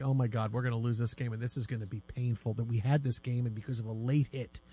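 low, soft, dejected groan of a doomed fan; mock despair. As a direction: moan it like the game is already lost. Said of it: "I'm like".

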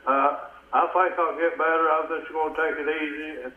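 ordinary talk

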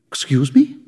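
startled question, lifting at the end; shocked. A narrator's reading. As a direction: ask it as if taken aback. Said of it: "her voice shocked".

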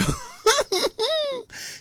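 high giggle at the listener's joke; laugh. Real, not acted. DJ amused by a caller's message, high laugh.

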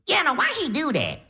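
put-on very high, brash Def Jam comedy accent; grandma's crazy accent. Grandma on morphine greeting her grandson, high brash comic accent. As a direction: imitate a Cat Williams style accent. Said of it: "talking in a crazy accent".

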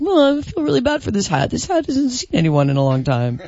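sweet puppy-dog pleading voice; guy voice. Retold or imitated. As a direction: plead like a sad little puppy. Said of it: "Was that your impression of Red-, That's, that sounds like a puppy dog".

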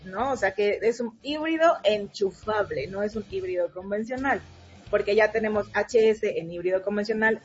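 normal talking voice

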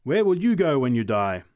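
fervent public warning; preacher's challenge. A narrator's reading. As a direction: confront the sinner before the crowd.

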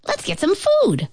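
high, eager suggestion; rally friends in a high voice; excited rabbit. A narrator's reading. Rabbit proposes party food.

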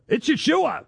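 high-pitched insistent comeback; excited insistence. Retold or imitated. Soldier answering the doubting officer, excited insistence.

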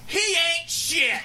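mock scream. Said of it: "she screamed out at the top of her lungs".

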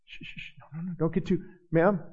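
whispered shh, then a soft scolding no; mock shushing. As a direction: hush an excited worshiper in mock disapproval.

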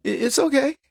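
casual SWAT taunting the hostage taker; mock careless dare. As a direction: shrug it off and dare him. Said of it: "swat says".

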